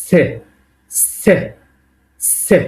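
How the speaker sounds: সে as a short, sharp "tseh"; pronunciation demo. Shown as a demonstration. Teacher drilling a letter that is not normal C, সে said short as "tseh". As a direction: model সে as one quick syllable, not "see". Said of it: "এটার উচ্চারণ হলো".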